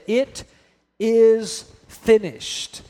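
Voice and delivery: drawn out, each word weighed; slow solemn declaration. Acted. Preacher voicing Jesus' last words, slow and solemn. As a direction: let it land slowly and gravely.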